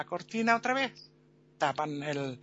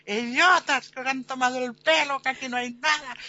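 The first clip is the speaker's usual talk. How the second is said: raised, high mock fury; exasperated shout; 3 semitones higher than usual. Captain atop the cart scolds the crowd, high exasperated shouting. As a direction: berate the fools from above.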